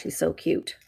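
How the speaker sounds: ordinary talk, nothing marked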